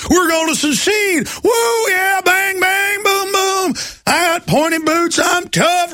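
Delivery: high, shouted, hammed-up cowboy yee-haw rant; mock Rick Perry. Mocking a governor as a whooping cowboy, high hammed-up shout. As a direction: whoop it up like a cartoon gunslinger. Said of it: "he would then come out and say".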